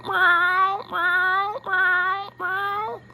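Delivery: high mock meows; cat meowing. Vlogger imitating the video cat Felix, high meows. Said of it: "the exact same thing as meow".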